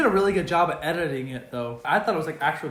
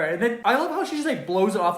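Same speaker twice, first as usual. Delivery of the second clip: normal talking voice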